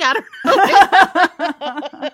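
slow, loud laughing I don't know. Real, not acted. Laughing to co-host about her first horror movie.

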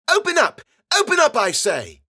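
put-on very high, loud child's command; bossy little girl. A narrator's reading. Bella rapping on the captive's forehead, bossy shout. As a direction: order it at a child's shriek.

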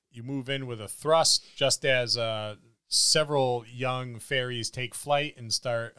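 ordinary talk

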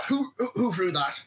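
imitating a stammered, blustering challenge; mock blustering. Game master imitating the player's character's challenge, blustering. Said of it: "you start blustering".

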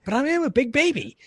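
put-on high pleading baby voice. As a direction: whine it like a grown baby. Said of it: "And I was like".

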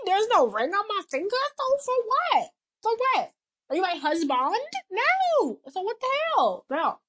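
high-pitched mock indignation; indignant rant. Woman telling off a needy date who isn't her husband, indignant.

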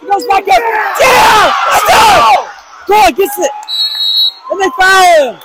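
high, loud live call; excited play-by-play. Real, not acted. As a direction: call every play at full hype. Calling the game live.

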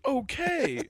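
high, falling "Okay" in a mocking voice; mock clerk reply. Customer mimics clerk's reply.

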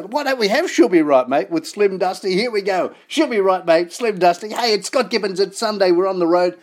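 loud, high, upbeat DJ announcing the next track; excited radio song intro. Real, not acted. Radio host introducing [name]'s song to Sunday listeners, excited and loud.

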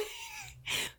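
laughing squeal; shrieking burst of laughter; high squealing laugh. Real, not acted. Laughs at nomination confusion.

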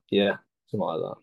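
ordinary talk

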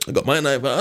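ordinary talk, nothing marked

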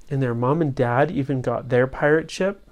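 plain reading voice

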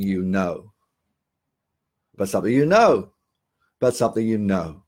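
"know" falls firmly at the end of each phrase; downward tone. Shown as a demonstration. Trainer showing callers a firm downward finish. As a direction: land each "About something you know" with a confident drop. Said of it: "have a downward tone when you're having a conversation".

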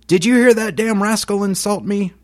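high-pitched enraged voice, a different character; hammed-up fury, pitched far up; violent outburst. Nelson raging at the bystanders, high-pitched and furious. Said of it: "General Nelson violently to the bystanders says".

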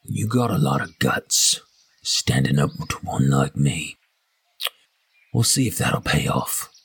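deep, deliberate intimidation into someone's ear; menacing in the ear. Acted. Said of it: "his mouth in your ear".

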